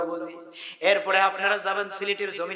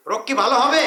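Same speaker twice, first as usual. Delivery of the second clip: rising, louder, with a laugh; louder rhetorical question; 5 semitones higher, 8 dB louder than usual. Shown as a demonstration. Preacher asks the crowd, louder with a laugh. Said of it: "আমার আলোচনা করলে আপনারা বুঝতেছেন".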